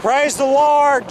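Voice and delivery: ordinary delivery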